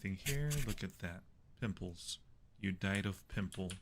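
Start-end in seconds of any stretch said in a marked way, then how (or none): none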